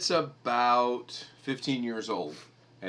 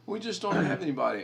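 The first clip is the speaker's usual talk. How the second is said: mimicking clerk; 7 semitones higher, faster than usual. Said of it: "she goes".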